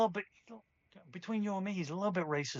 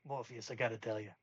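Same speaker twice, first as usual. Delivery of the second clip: lower, softer, amused voice of Neil; low and delighted, hold back a grin; 6 semitones lower, 5 dB quieter than usual. Acted. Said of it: "But he's like".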